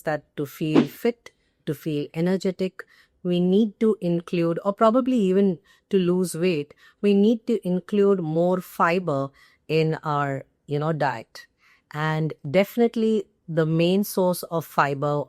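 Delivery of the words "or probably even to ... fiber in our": usual speaking voice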